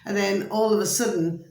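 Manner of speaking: normal talking voice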